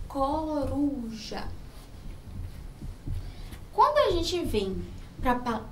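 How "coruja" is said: "Coruja" very slowly, opening co drawn out; sounding out syllables. Shown as a demonstration. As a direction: stretch out every syllable of "Coruja". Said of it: "Então, C e o O". ("Coruja" bem devagar, com as sílabas alongadas)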